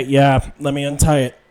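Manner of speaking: ordinary talk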